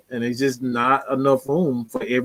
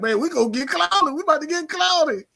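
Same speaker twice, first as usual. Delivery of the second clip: high, quieter giddy fan imitation; mocked Saints fans; 13 semitones higher, 3 dB quieter than usual. Imitating Saints fans expecting a signing, high mocking voice. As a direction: mimic hopeful fans high, about to crack up. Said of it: "so many Saints fans like".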